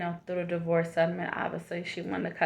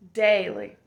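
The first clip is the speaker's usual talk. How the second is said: daily said out firmly, a touch louder; emphatic daily; 6 dB louder than usual. Shown as a demonstration. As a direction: land the word daily like a promise. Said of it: "repeated word said loud".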